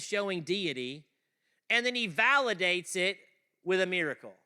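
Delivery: loud, high sermon delivery; booming pulpit preaching; full-force preaching. Acted. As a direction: drive the point home to the room.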